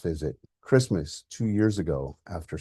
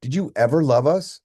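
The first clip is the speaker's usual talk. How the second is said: louder, higher, hurt and furious; angry shouting; 6 semitones higher, 7 dB louder than usual. Acted. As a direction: yell the accusation at the father. Son confronts absent father angrily.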